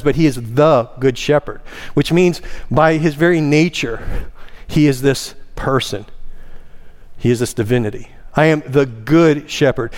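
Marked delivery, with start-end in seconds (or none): 0.1-1.6 single him out by leaning on "the"; stress on THE. Shown as a demonstration. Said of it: "There are other good shepherds". 8.3-9.9 "good" punched and lengthened; stress on GOOD. Shown as a demonstration. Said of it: "That word good actually means".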